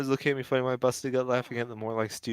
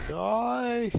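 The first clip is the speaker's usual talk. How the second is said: shout; 10 semitones higher than usual. Real, not acted. Finishing blow in game.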